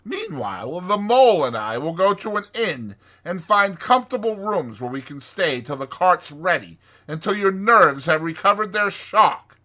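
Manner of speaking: brisk, scolding Rat voice; sharp. A narrator's reading. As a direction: order Toad about sharply. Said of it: "said the Rat sharply".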